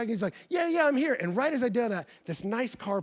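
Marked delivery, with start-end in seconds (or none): 0.5-1.1 high, loud casual reassurance; breezy reply. Retold or imitated. Contact greeting the youth pastor, breezy and casual. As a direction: wave off the worry.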